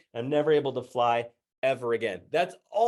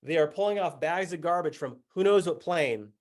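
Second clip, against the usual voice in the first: ordinary talk, nothing marked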